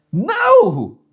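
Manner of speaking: high, loud indignant no; a sharp high-pitched no, no way; outraged refusal. Acted. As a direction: refuse the friend outright, indignant.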